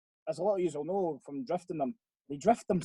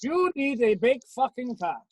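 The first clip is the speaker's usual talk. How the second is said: slow, higher put-on Welsh accent; mock Welsh accent; 7 semitones higher, 3 dB louder than usual. Max advising his friend on cars, mock Welsh accent. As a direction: spell it out in a fake Welsh lilt. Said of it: "so I'll do a Welsh one instead".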